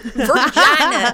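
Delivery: vagina said slow and high, laughing. Real, not acted. Echoes the word, laughing.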